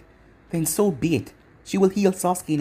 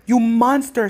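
high, louder outraged cry; narrated scream; 7 semitones higher, 6 dB louder, slower than usual. A narrator's reading. Girl accusing her former teammate, screamed accusation. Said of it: "she screamed".

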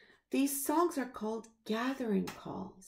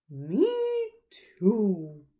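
frog; 8 dB louder than usual. Acted. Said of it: "says the frog".

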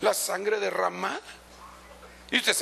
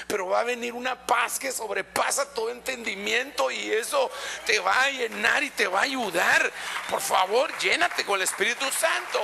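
fervent preaching; 6 semitones higher than usual. Real, not acted. The pastor urges the brothers to be filled with the Spirit, raised and fervent. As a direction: urge the congregation with rising fervour.

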